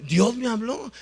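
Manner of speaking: high, loud proclamation; emphatic insistence. Retold or imitated. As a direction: proclaim it, pitch and volume up. Drunkard insists a third time.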